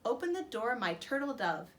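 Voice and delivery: usual speaking voice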